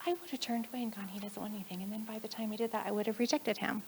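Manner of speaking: very soft retold admission; hushed confession. Friend admitting she nearly turned away from the boy, hushed.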